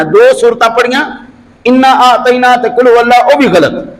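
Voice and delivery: high-pitched, emphatic sermon rebuke; preacher correcting a serious mistake; admonishing preaching. Real, not acted. Preacher rebukes wrong recitation order.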